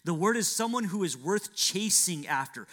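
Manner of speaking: normal talking voice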